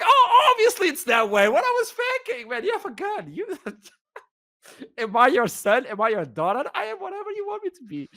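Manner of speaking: high, frantic comic pleading; mock panic. Passenger pleads with armed guard, comically panicked.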